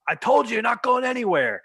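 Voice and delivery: high, loud enraged grandfather shout; furious yell. Retold or imitated. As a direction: bellow it as he swings. Grandfather freaks out, hits.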